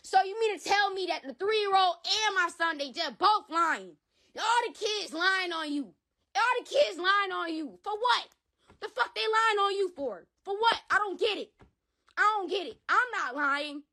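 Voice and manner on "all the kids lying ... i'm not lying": raised, pleading disbelief, voice lifted high; exasperated disbelief. Real, not acted. Father to the man accused, rising in disbelief. Venting about kids lying.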